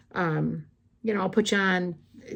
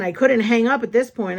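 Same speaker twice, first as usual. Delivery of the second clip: ordinary talk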